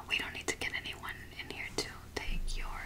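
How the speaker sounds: normal talking voice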